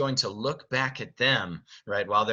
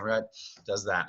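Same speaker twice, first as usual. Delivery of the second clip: normal talking voice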